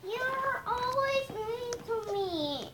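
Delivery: high, soft, slow plaint; hurt complaint. Real, not acted. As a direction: complain softly and high, drawing it out as if wounded. Hurt child complaining to sibling.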